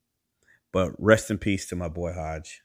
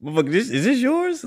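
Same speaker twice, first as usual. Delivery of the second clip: very high, squeaky dog voice asking; voicing the dog; 4 dB louder than usual. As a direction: play the sniffer dog as an accusing cartoon. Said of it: "the dog even looked back at me like".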